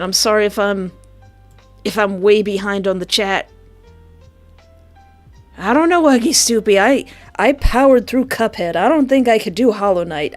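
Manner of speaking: ordinary delivery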